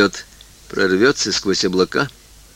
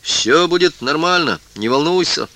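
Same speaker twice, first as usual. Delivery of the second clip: slightly gruff; 6 semitones higher than usual. Acted. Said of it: "стараясь говорить несколько грубовато".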